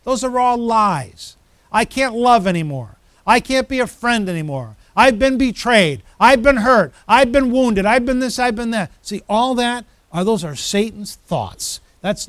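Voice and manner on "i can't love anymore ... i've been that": higher, insistent litany of hurts; mock wounded complaint. Preacher voicing Satan's lies in believers' heads, mock wounded complaint. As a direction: pile up grievances as a hurt believer would.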